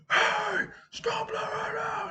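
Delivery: very high, strained slow shout; mock loudest yell. Teacher breaking up a fight, high mock yell without anger. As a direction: imitate a big yell without the full volume. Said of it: "I did my loudest voice".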